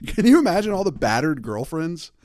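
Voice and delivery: normal talking voice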